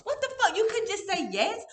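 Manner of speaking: surprised. Real, not acted. Friend reacts to dad secretly sending money, surprised exasperation. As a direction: squeal it in disbelief.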